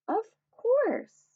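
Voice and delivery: a timid small toy asking. Acted. Benji tells the hippo about cupcakes, high and unsure. Benji answers Dottie warmly.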